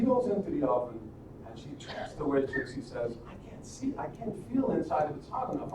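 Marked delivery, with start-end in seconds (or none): 3.3-5.7 put-on high, hushed little-girl voice; feigned helplessness. Gretel tricks the witch at the oven, feigning helplessness in a small high voice.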